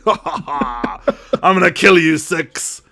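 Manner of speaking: slow mock villain gloat; lightly hammed cartoon villain; mustache-twirling villain. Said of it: "twirling it with his plunger hand".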